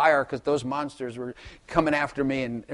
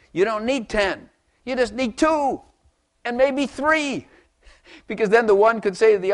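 rising fervor; 7 semitones higher, 4 dB louder than usual. Real, not acted. Preacher contrasting ten men with two, rising fervor. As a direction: build up to three.

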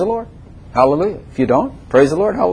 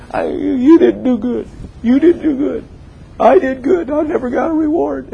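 whiny complainer; 10 semitones higher than usual. Pretend. Mocking a self-righteous complainer.

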